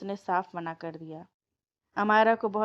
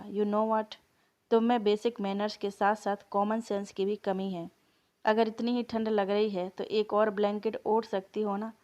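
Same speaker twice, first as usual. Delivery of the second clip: ordinary delivery, nothing marked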